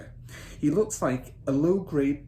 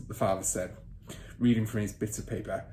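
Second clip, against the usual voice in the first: ordinary delivery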